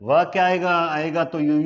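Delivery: ordinary talk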